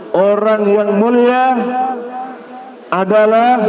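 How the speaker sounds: teacher chanting loudly for students to echo; loud recitation. Shown as a demonstration. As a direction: lead the students loudly, word by word. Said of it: "Coba ikut saya dengan suara yang keras".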